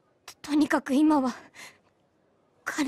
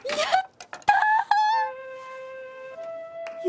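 high, drawn-out squeal of joy; long, high-pitched "yay"; overjoyed cheer; 12 semitones higher, much slower than usual. Acted. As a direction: cheer a dream come true. Thrilled at lunch together.

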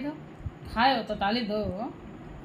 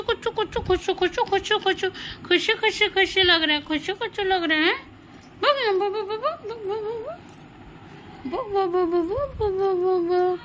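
tickly nonsense chatter, pitched way up; 7 semitones higher, 3 dB quieter than usual. Real, not acted. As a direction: coo it high, in quick repeated syllables. Mother coos at baby after massage.

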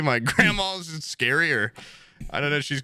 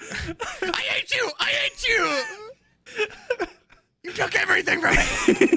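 much higher, slow shrieking mock fury; hammed-up rage; slower than usual. Cartoon killer screaming at his victim in hammed-up fury. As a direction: wail it like a melodramatic cartoon.

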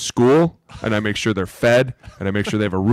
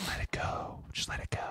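breathless, soft whisper; whispered let it go; 18 dB quieter than usual. Retold or imitated. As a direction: hush it like a soothing coach. Coaches' advice mock whispered.

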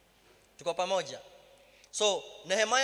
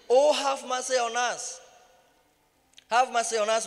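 loud, higher pleading call; pleading cry; 4 semitones higher, 5 dB louder than usual. A narrator's reading. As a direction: cry out for mercy from the roadside. Blind men crying to Jesus.

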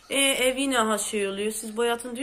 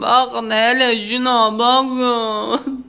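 much louder, drawn-out look at this; worked up; 9 dB louder, slower than usual. Real, not acted. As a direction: stretch the bakın in exasperation. Showing damage, worked up. (evdeki hasarı gösterirken yüksek sesle sitem)

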